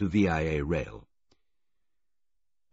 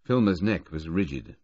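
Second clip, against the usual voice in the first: usual speaking voice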